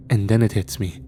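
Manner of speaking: ordinary delivery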